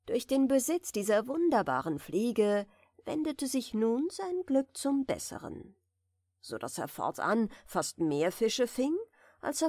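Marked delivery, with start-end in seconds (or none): none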